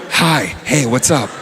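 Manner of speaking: lightly voiced casual lurker; a hint of a too-chummy stranger; the world as a person. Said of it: "Right on your shoulder going".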